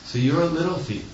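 high-pitched knowing "Oh"; mock surprised realization. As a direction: imitate a teacher's teasing accusation. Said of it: "eyebrows raised up and said".

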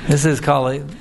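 usual speaking voice

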